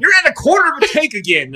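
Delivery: high, loud wife impression; nagging wife voice. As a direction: mimic the complaining wife. Voicing his wife's complaint.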